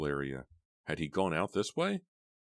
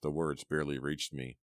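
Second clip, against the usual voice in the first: ordinary delivery, nothing marked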